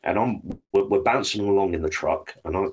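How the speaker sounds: normal talking voice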